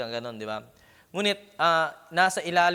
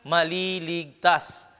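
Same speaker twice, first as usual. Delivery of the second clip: raised, higher preaching voice pressing each phrase; fervent preaching; 5 semitones higher, 5 dB louder than usual. Acted. Preacher exhorting the church, raised fervent preaching. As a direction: proclaim it to the whole congregation.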